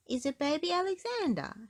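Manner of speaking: put-on high piggy child asking; curious little girl; high Peppa voice. A narrator's reading. Peppa asks Mummy about the photo.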